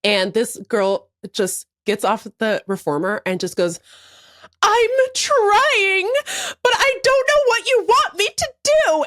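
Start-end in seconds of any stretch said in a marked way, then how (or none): 4.6-9.0 mock tearful outburst. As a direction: act out someone breaking into tears, pitched way up. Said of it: "And then she bursts into tears".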